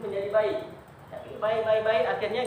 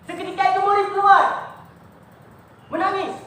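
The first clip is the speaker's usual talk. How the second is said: high, loud dramatic telling; rising storyteller cry; 10 semitones higher, 8 dB louder than usual. A narrator's reading. Preacher telling how the student left in tears, loud and high. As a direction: lift the voice at the turning point.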